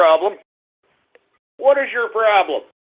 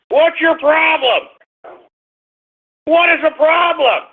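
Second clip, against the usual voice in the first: higher, raised voice demanding an answer; real irritation, pitched up and pushed; exasperated at the dog; 4 semitones higher than usual. Real, not acted. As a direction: snap at the whining dog, fed up. Host scolding his crying dog.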